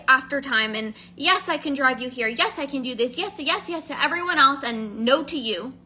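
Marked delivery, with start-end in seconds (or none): none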